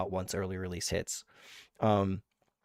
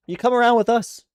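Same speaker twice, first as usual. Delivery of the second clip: excited; 12 semitones higher, 6 dB louder than usual. Real, not acted. Hyped invite to a fellow guild player.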